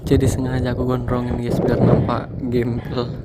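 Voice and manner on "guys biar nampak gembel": laughing. Real, not acted. Joking about his messy hair.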